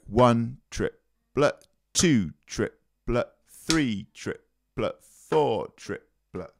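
slow triplet count. Shown as a demonstration. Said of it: "which you make as the upstroke".

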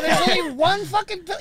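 high, loud mock fury; hammed-up indignant protest; outraged complaint. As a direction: yell it in comic disbelief. Acts out outrage at the pharmacy.

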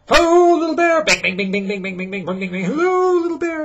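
very high, loud calling over the banging pots; loud singsong calling. Acted. Papa Bear bangs pots on the stove, calling high and loud. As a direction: holler it high while banging pans. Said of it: "said Papa Bear, banging in pan".

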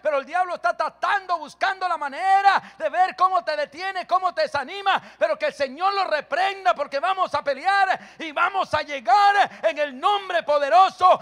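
loud, high, driving sermon cadence; fiery preaching. Acted. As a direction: preach it at full force to the congregation. Preaching at full force.

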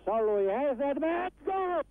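very high goal cry; goal call. Real, not acted.

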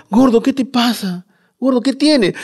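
high-pitched worried wife. Retold or imitated. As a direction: play the frightened wife calling her husband. Worried wife voiced in story.

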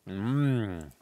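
ordinary talk

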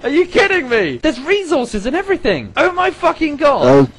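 high, loud amazed gushing; excited. Real, not acted. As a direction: burst out in amazement. Amazed at tunnelling.

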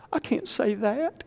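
high, drawn-out whiny falsetto; mock humble listener. Preacher mimics a listener too modest to say it, in a high whine. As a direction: whimper it like a falsely modest churchgoer. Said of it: "You say".

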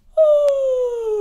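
loud, high mock gasp; exaggerated storytime gasp; big gasp. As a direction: gasp theatrically for the kids. Reacting to the word yawn.

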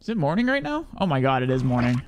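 usual speaking voice

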